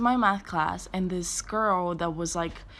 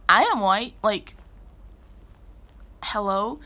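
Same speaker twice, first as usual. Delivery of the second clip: indignant retort; 3 semitones higher, 6 dB louder than usual. Retold or imitated. Retold indignant reply to classmate.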